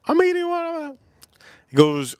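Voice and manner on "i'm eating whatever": high mock date voice.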